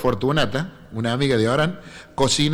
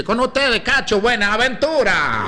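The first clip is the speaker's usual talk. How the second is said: higher, drawn-out announcer call; speaker announcing; 7 semitones higher, slower than usual. Retold or imitated. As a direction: present the star like a stage speaker. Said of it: "Sale el speaker y dice". (anuncio de presentador en un festival, agudo y alargado)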